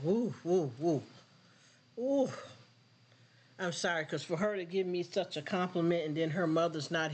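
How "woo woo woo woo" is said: overcome, exhaling in woos; overwhelmed whew. Real, not acted. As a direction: let out repeated woos to shake off emotion. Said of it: "Y'all got me like".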